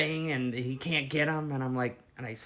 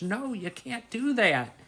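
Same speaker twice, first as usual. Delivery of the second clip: pleading protest; 9 semitones higher than usual. Retold or imitated. Banging on tank at otter.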